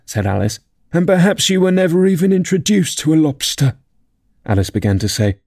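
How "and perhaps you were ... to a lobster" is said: high, mildly mournful character voice; a touch of tearful melancholy, pitched high; tearful Mock Turtle. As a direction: lament gently in a raised pitch. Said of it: "with tears running down his cheeks".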